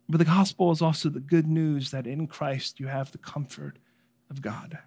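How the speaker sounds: normal talking voice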